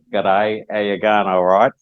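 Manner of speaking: light mock Australian accent, casual greeting; a playful hint of Australian twang; half-joking Aussie accent greeting. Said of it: "put on my best Australian accent".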